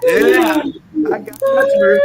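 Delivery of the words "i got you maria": high, gleeful teasing call; delighted gotcha to Maria; gleeful tease. Real, not acted. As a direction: catch her out with a squeal.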